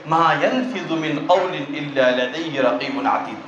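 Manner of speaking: low, chanted Arabic verse; Quran recitation. Acted. Quotes Quran verse in sermon.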